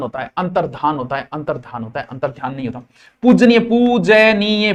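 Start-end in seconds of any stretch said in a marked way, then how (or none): 3.2-4.7 पूजनीय drawn out slowly, syllable by syllable; slow spelling drill. Shown as a demonstration. Teacher showing students how to say it, slow drill. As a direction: stretch out पूजनीय slowly for the class. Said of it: "पूजनीय ऐसे लिखा जाएगा".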